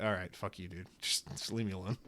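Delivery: mock grumbled comeback; muttered under breath. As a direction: mutter it half to yourself. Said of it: "I was like".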